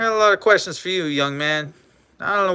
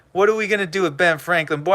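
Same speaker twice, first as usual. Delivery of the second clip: normal talking voice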